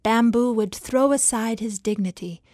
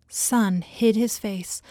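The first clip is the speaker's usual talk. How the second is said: ordinary delivery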